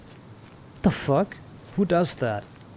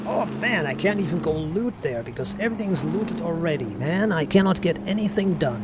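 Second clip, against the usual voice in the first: whiny complaint; 3 semitones higher than usual. Retold or imitated. Streamer mocking players who complain to admins, whiny. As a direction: moan about everything being looted. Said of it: "they go like".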